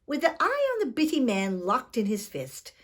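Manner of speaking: plain reading voice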